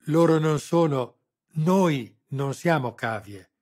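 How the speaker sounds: loud, slow, digging in her heels; stubborn, refusing to budge; stubborn repeat. A narrator's reading. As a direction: insist word by word, high and loud. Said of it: "ripeté cocciuta".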